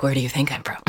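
whispered teasing question; coy whisper asking to guess her hometown; playful whisper. Pretend.

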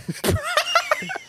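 high burst of laughter; cracking up at a mate's line; big laugh. Real, not acted. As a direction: let a real laugh break out high. Host cracks up at joke.

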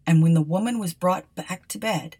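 ordinary delivery

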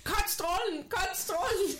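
high urgent repeat. Retold or imitated. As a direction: beg it to stop, high and urgent. Retold inner command while peeing.

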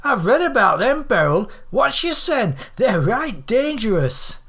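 high, lively northern gossip; alarmed old Doris. A narrator's reading. Doris warns Beryl about dangerous weirdos, alarmed. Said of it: "seized on this with frightening rapidity".

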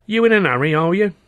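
put-on high, languid cheeky kid; street urchin voice. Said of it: "the urchin asked, languidly".

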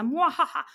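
evil villain cackle, high and loud; mustache twirling villain. Pretend. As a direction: gloat with a cartoon bad-guy laugh. Mock secret-keeper gloating over success.

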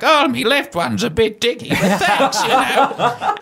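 high, laughing mock reply; a jokey put-on voice, laughing; laughing high quip. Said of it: "I'm like".

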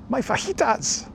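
high, slow squeaky cartoon voice; quoted TV catchphrase. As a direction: squeak the line like a kids' show character. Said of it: "what television program am I, uh, quoting from?".

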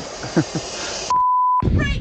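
a sudden squeal of a laugh, very high. Acted. Ending his message to Roger with a squealing laugh. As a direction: let out a shrill giggle.

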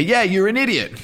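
high-pitched mock jeer from a volunteer; imitated taunt. Volunteer insults the presenter in the idiot game, taunting.

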